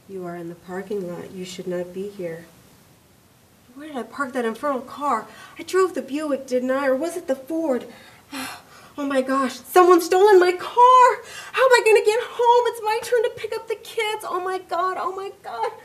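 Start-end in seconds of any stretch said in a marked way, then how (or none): 0.1-2.5 a gentle woman speaking from above and behind; calm facility voice. Acted. The building's voice telling Mary where she is, calm and soft. 9.5-15.7 high, loud, frantic cries; panicked. Acted. Mary panics that her car was stolen, high and frantic.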